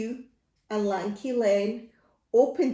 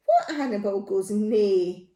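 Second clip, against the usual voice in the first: ordinary delivery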